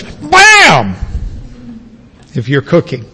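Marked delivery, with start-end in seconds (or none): 0.0-1.0 a chef's spice shout; emphatic Bam. Shown as a demonstration. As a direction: slam the word down. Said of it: "So in English".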